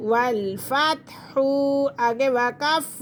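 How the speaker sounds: ordinary talk, nothing marked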